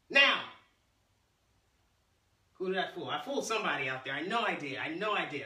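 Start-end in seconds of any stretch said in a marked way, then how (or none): 0.1-0.4 loud sharp fake command; sudden shout. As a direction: bark it suddenly to trick them. Fake-out command to fool class.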